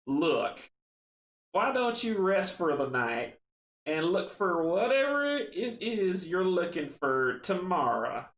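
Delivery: put-on old villager's slow, lilting Southern drawl; Southern accent. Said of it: "my southern accent".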